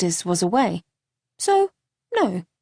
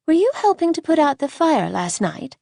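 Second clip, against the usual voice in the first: put-on curious little girl; 7 semitones higher than usual. A narrator's reading. Little girl questioning a boy in a tree, curious and high. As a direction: call up like an inquisitive child.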